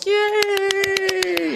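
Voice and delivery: high mock cheering; hammed-up little Philistine cheer. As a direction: squeal like a small crowd. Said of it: "little Philistines up on the hill going".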